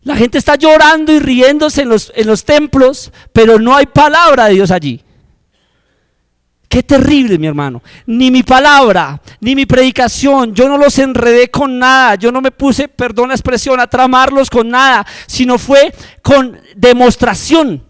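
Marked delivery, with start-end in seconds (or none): 0.0-5.0 raised, insistent warning; louder preaching of the quote; quoting the teacher. Retold or imitated. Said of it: "Y me dijo". 8.1-17.8 loud, higher proclamation; full-force pulpit preaching; fiery preaching. Acted.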